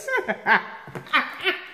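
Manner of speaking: high burst of laughter; delighted laugh. Real, not acted. Vlogger loving the hookup, high delighted laugh.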